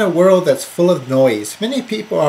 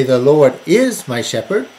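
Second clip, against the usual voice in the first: emphatic "is", rising at the end; stress on IS; 3 semitones lower than usual. Shown as a demonstration. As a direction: put all the weight on "is". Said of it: "we're thinking about the present".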